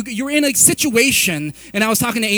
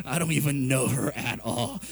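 inner thought; 8 semitones lower, 5 dB quieter than usual. Retold or imitated. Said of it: "I don't know if you've ever thought that".

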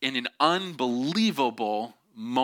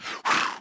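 voiceless blown whoosh of a thrown rope; breathy throwing noise; imitated whoosh. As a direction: puff out a rushing-air sound effect.

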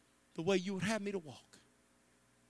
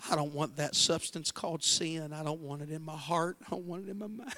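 model prayer. Shown as a demonstration. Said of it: "Pastor, this is what you say".

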